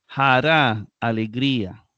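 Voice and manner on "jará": "Jara" loud, as ja-RÁ; louder, confirming "Jara" with end stress; stress on last syllable. Shown as a demonstration. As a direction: punch the final "ra" of "Jara" and draw it out. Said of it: "jara se tilda en la última".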